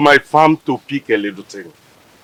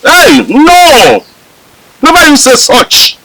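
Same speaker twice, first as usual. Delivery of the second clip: much higher and louder, forbidding it; alarmed protest; 13 semitones higher, 7 dB louder than usual. Acted. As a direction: shout him down in alarm. Elder rejects giving up.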